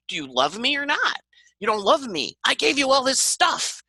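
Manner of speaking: hammed-up wounded lover voice; needy hurt lover. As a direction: act out someone begging to be valued.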